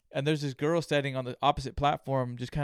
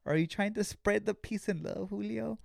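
calming self-talk; 7 semitones higher than usual. Retold or imitated. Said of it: "I'm gonna have to calm down".

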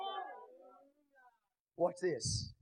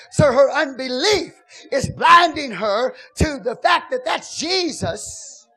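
loud, ringing preacher's cry over the music; full-force preaching; 4 semitones higher, 8 dB louder than usual. Acted. Preacher urging the church to speak to their souls, loud and fervent. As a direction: whoop it up like a preacher stirring the house.

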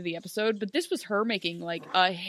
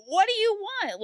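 exasperated; 11 semitones higher, 4 dB louder than usual. Retold or imitated. Exasperated jab at Regina.

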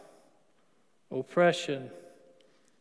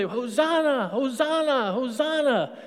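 raised, higher cries of Hosanna; crowd shouting Hosanna; 5 semitones higher than usual. Retold or imitated. Crowd in Jerusalem hailing Jesus, shouting Hosanna. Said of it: "That's what they".

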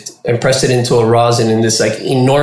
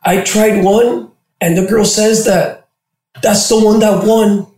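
put-on high girl voice; 8 semitones higher, slower than usual. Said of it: "She goes".

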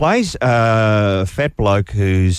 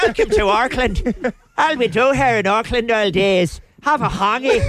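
high mock Kiwi accent; mock New Zealand accent; 9 semitones higher than usual. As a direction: ham up a bad Kiwi accent. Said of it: "New Zealand accent".

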